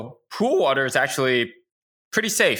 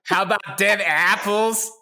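slow, higher, hammed-up Boston drawl; mock Good Will Hunting Boston accent; bad Boston accent, stretched out; 6 semitones higher, slower than usual. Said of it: "Good Will Hunting".

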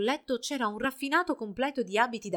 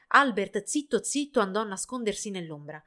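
ordinary delivery, nothing marked

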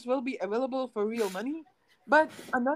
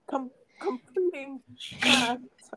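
ordinary delivery

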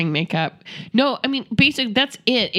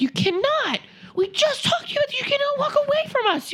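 very high, exasperated parent voice; mock scolding. Parents telling off the lost child, high mock scolding. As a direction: scold in high-pitched disbelief.

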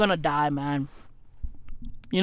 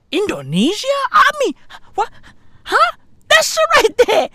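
shocked disbelief; 13 semitones higher than usual. Acted. Shocked by the pollution list.

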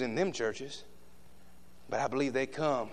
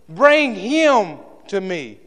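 slow command; 8 semitones higher, 14 dB louder, much slower than usual. Acted. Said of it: "and said".

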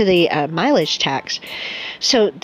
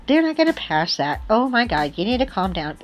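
mock dismissive friend; 3 semitones higher, 3 dB quieter than usual. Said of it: "I've been told".

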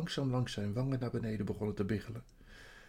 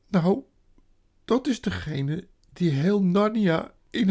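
high, loud self-reproach; woeful creature crying out; tearful wailing faun; 8 semitones higher, 9 dB louder than usual. A narrator's reading. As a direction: bewail your own wickedness. Weeping faun describes the witch.

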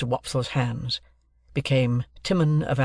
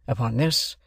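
ordinary delivery, nothing marked

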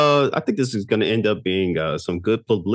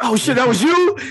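shocked realization; 6 dB louder than usual. Retold or imitated. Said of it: "You're like".

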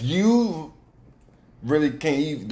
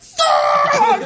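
high, loud yell through laughter; hammed-up furious outburst; mock frustrated scream; 8 dB louder than usual. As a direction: imitate a driver screaming in rage. Acts out furious crashed driver.

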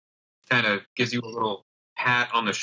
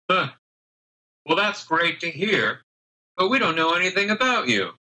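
scoffing halfling; 6 semitones higher than usual. Acted. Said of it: "who kind of scoffs a little bit and says".

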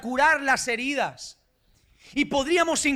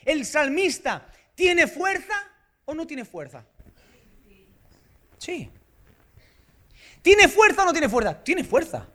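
ordinary talk

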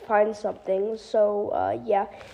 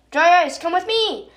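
excited call; 6 semitones higher, 4 dB louder than usual. Acted. Bloom pulls Dry Eyes along.

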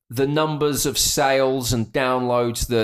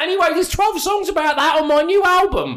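[name] impression. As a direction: ham up a brash Cockney [name] voice. Mocking [name] in a Cockney impression.

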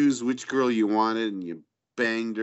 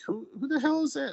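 puzzled retold question; faster than usual. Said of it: "I'm like".

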